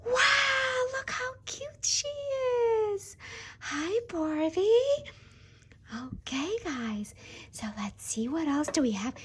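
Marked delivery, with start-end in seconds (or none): 0.1-5.1 high, slow, awed delight; excited gasp. Real, not acted. Host sees the doll's face, high and slow with awe. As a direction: marvel at how cute she is.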